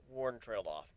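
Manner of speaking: ordinary delivery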